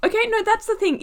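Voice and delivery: high-pitched, insistent protest; worked-up, emphatic objection; heated objection. Real, not acted. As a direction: burst in high to argue back. Heated objection in accent rant.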